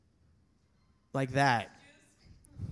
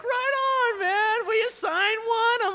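much higher, loud, gushing; gushing high fan; 6 dB louder, slower than usual. Retold or imitated. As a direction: beg for an autograph, squealing.

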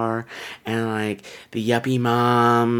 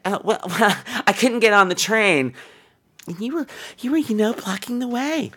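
a higher, flustered, giggly excuse; mock flustered woman; 8 semitones higher than usual. Woman on the subway excusing herself, higher and giggly. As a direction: play her fumbling excuse with a nervous laugh. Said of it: "she's like".

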